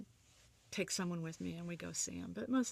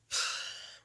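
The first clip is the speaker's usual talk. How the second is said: heavy exhaled sigh; regretful sigh; 3 dB louder than usual. Real, not acted. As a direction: let out a long breath of regret. Regrets not bringing him food.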